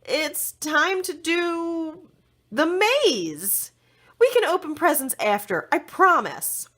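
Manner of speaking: stall, then promise firmly; Magnolia's voice, reluctant. A narrator's reading. Magnolia hurries friends into the maze, high anxious promise. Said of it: "Princess Magnolia frowned".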